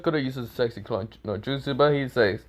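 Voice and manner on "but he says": usual speaking voice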